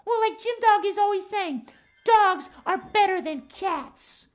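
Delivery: lilting cat character, key words drawn out; Max the cat. Acted. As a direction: play a cat puppet airing a grievance. Puppet cat Max complains.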